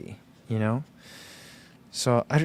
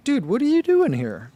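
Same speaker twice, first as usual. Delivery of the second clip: surprised; 12 semitones higher than usual. Retold or imitated. Surprised greeting of friend.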